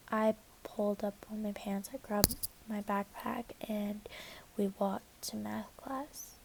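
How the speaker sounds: hushed, unvoiced whisper. Real, not acted. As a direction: whisper it under your breath. Whispering the aftermath.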